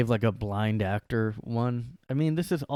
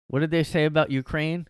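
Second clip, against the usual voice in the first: ordinary talk, nothing marked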